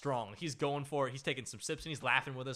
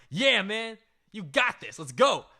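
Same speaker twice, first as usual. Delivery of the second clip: louder, higher hype-up shout; hype cheer; 7 semitones higher, 8 dB louder, slower than usual. Retold or imitated. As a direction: cheer your buddy on. Friends hyping Paul up.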